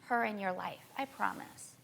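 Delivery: gently reassuring, near murmur, as she recalls it; soft quoted promise. Retold or imitated. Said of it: "and she was like".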